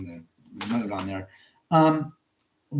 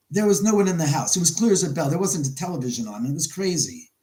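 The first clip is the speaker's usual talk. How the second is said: usual speaking voice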